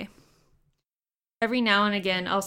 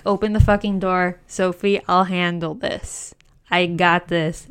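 louder, commanding mom voice; take-charge mom; 7 dB louder than usual. Retold or imitated. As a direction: bark it like a mother taking over. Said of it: "And she's like".

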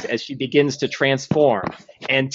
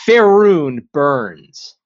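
dramatic title; 6 dB louder, much slower than usual. Acted. DM announces title.

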